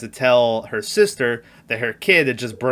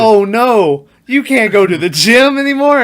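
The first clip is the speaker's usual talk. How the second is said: much higher and louder, mocking sympathy; sarcastic mom voice, pitched way up; mock shocked mom; 9 semitones higher, 8 dB louder than usual. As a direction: overdo the fake concern. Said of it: "And Mom's like".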